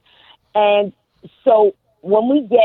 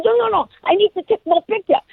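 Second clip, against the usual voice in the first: much higher, insistent imitation of her Japanese accent; mock Japanese accent; 13 semitones higher than usual. Woman refusing to move on, high mock Japanese accent. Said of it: "She goes".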